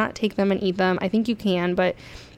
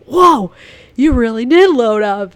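much higher, louder astonished whoa; amazed whoa; 4 semitones higher, 10 dB louder, slower than usual. Retold or imitated. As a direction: play the stunned marina guy. Marina guy amazed at catch.

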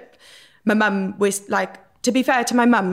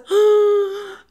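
big high gasp of shock; 13 semitones higher, 4 dB louder than usual. Retold or imitated. Caught by the headmaster, a loud high shocked gasp. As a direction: gasp like you've just been caught. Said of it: "And I was like".

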